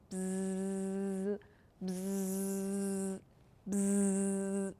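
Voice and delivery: long, low, soft buzzing; sustained buzz on one note; bee buzz. Shown as a demonstration. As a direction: imitate a bumblebee humming by. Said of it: "Hear its funny sound".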